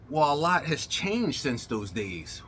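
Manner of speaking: ordinary talk, nothing marked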